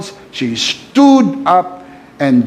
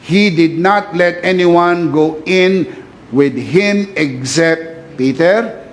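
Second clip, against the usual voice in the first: usual speaking voice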